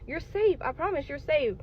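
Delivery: high, soft comforting nurse voice; calm a frightened patient, high and gentle; reassuring nurse. Retold or imitated. Nurse reassures the panicking patient she is safe, high and tender. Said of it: "Came over and tried to grab my phone and was like".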